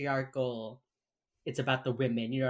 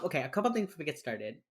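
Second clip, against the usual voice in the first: usual speaking voice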